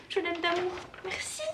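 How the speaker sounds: high, cooing gratitude; tender thanks. Real, not acted. Thanking a friend for her drawing, tender delight. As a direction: melt with affection, high voice.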